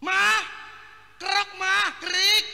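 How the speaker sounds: loud, high call to the wife; husband calling his wife for a scraping. Acted. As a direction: call her over to scrape him. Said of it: "Lakinya ngomong".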